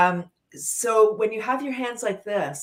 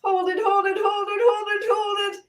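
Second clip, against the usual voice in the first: much higher, held-breath hold it; strained hold; 12 semitones higher, 3 dB louder than usual. Shown as a demonstration. Leader holding breath with the group, high strained voice. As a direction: squeeze the words out holding the breath. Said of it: "breathe in".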